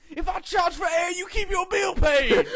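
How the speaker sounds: high, loud, slow bellow; yelled threat. Retold or imitated. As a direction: roar it at the crowd. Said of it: "he yelled the most badass shit".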